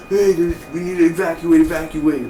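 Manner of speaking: high, urgent evacuation call; hammed-up alarm; mock panic. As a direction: raise the pitch like a panicked bystander. Acting out people fleeing a bomb.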